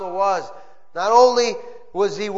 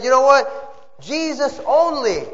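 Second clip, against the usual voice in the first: higher, louder cry of Jesus only; preacher's ringing exclamation; fervent exclaim; 6 semitones higher, 3 dB louder than usual. Acted. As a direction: proclaim it out. Preacher's high point.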